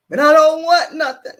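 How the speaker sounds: loud, high-pitched mock wail; still crying. Retelling her refusal to write a list, mock crying. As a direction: protest loudly as if in tears. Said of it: "And I'm still crying".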